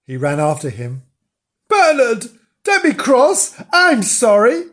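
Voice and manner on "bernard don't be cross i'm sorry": high, loud, urgent apology; upset pleading. Acted. Frank running after Bernard to apologise, upset pleading. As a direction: plead anxiously, pitched right up. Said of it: "Frank was very upset".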